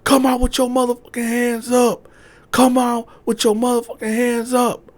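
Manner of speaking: very high, loud orders; police commands. Retold or imitated. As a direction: bark the order twice.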